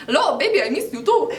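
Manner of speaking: high, loud sing-song caller voice; imitated caller. Girl quoting the caller to explain her laughter, put-on voice. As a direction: mimic the lovey caller, near laughing. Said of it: "this man just said".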